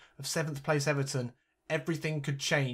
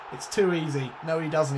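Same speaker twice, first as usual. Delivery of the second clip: ordinary talk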